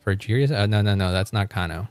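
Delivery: ordinary talk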